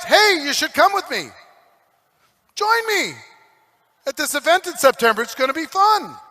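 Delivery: high-pitched, eager amateur's invite; chirpy invitation. Acted. Amateur inviting someone to a September event, chirpy. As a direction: pitch it up like an easy, fun invitation.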